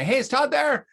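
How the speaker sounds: caller asking. Retold or imitated. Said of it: "and they'd say".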